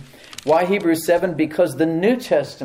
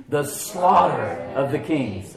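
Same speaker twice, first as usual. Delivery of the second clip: "The slaughter of the kings" drawn out slowly, every word stretched; slow call-and-response; much slower than usual. Shown as a demonstration. Preacher leading the congregation in unison, very slow. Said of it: "Say that with me".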